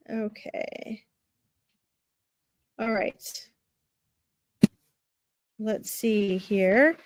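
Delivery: drowsy, slow and dragging; half asleep, words trailing out; nodding off. Real, not acted. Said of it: "I see I'm half asleep".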